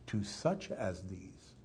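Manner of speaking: "such" slowed and leaned on, quiet and low; slow hushed emphasis on "such"; stress on such. Shown as a demonstration. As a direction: dwell on "such", softly and slowly. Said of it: "he doesn't say the kingdom of God belongs to these".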